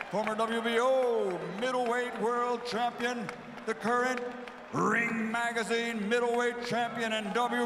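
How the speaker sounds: building to a high, stretched name shout; lucha libre announcer. Real, not acted. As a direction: roar the champion's name slowly. Spanish crowd intro, name stretched.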